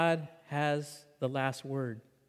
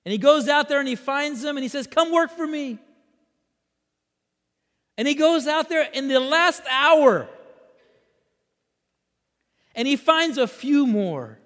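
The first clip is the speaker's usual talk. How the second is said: high, loud storytelling from the pulpit; animated preaching; 10 semitones higher, 9 dB louder than usual. Real, not acted. Preacher retelling the farmer's hiring, animated preaching. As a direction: press the parable forward with energy. Preaches the parable at full force.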